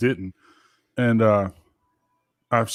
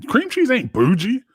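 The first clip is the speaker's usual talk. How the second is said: incredulous; 9 semitones higher, slower than usual. Real, not acted. Incredulous at friend's claim.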